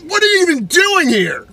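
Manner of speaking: shrill, very high, loud yelling; furious high-pitched road-rage yell; mad shout. Retold or imitated. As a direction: scream at him in a rage. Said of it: "Just mad at this guy".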